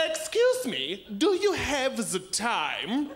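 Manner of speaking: slow menacing mock German accent; German supervillain. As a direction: ask the time like a Bond villain with a German accent. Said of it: "they sound like a freaking supervillain".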